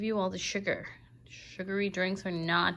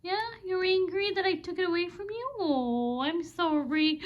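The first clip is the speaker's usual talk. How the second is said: high sing-song pet talk with gasps; 10 semitones higher than usual. Real, not acted. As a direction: coo at him sweetly to make up. Baby talk to pet bird.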